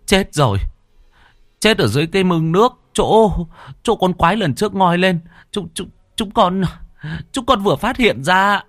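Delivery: frightened soldier reporting the body to his master; panicked stammer. A narrator's reading. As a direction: gasp it out, stumbling, pale with fear. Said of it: "mặt tái nhợt".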